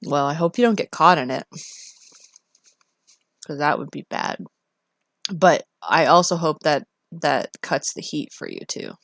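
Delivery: ordinary talk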